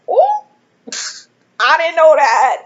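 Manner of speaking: high, loud gasp of surprise; a sudden, bright "oh" that jumps way up; surprised. Retold or imitated. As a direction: caught off guard and delighted. Learning news from mentor.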